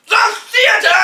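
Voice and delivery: high, very loud cry; horrified outburst. Acted. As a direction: shout the dreaded word melodramatically. Doctor's horrified climax repeated.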